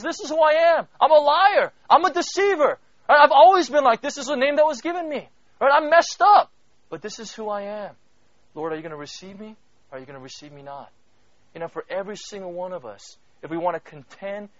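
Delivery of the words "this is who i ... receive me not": Jacob's confession. Pretend. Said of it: "He says".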